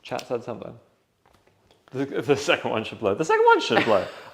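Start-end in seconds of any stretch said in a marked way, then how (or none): 3.1-4.0 louder, higher insistent repeat; emphatic. Real, not acted. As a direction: push it with excitement.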